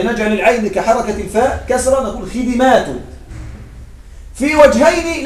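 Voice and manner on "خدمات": خدمات said khidimāt, i after the d echoing the first kasra; plural with itbaa. Shown as a demonstration. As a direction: say خدمات as khi-di-māt. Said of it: "ومع الإتباع لحركة الفاء". (خدمات بكسر الدال إتباعا: خِدِمات)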